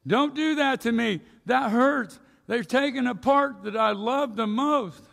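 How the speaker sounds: high, loud cry of a hurting stone; pained stone's protest. Pretend. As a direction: beg the chisel to stop. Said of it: "being a stone when a chisel strikes".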